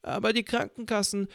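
put-on high whiny old woman; old lady voice. A narrator's reading. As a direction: play the self-pitying old lady, high-pitched.

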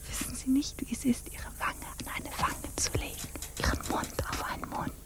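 soft breathy whisper; intimate whisper. Acted. The officer tempts her with a kiss, intimate whisper. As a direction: draw her in, barely voiced.

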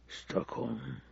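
breathy whisper, almost no voice; whispered, pondering a city name; whispered to himself. Acted. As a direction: let the name fade into a whisper as it sinks in.

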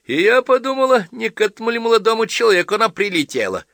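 high, loud teasing boast; boastful hint. A narrator's reading. Wife boasting about the girl guest, high and loud. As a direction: tease them with the news. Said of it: "хвастливо продолжала попадья".

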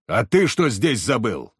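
slow, emphatic challenge in a narrator's mock bellow; gruff bellow. A narrator's reading. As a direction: challenge him gruffly without really shouting. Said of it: "во весь голос гаркнул". (сдержанно изображённый окрик)

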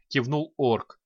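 ordinary delivery

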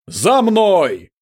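high, loud order to follow; shouted command. Acted. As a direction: call the troops to follow. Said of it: "громко крикнул Прам".

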